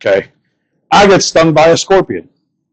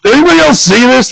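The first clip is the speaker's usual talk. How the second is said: stunned; 7 semitones higher than usual. Retold or imitated. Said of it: "looking at this thing like".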